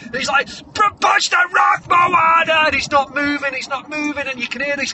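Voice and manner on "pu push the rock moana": loud, high trainer's yell; hammed-up montage coach hollering; shouted urging. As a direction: drive the athlete on at full shout. Trainer urging Moana to push.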